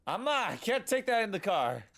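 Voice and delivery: high, loud exasperated dad voice, cursing; fed-up dad. Retold or imitated. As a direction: play the dad who has had enough of it all. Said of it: "the dad is just, like, fed up".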